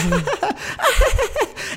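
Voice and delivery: high, light mock giggling; put-on high titter; imitated laughter. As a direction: imitate movie characters giggling through a montage. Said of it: "and then them going".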